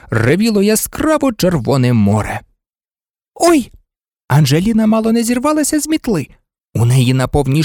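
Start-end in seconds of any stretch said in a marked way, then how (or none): 3.3-3.7 high, sudden little cry; startled yelp. A narrator's reading. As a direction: yelp as if knocked sideways. Cry as player is rammed.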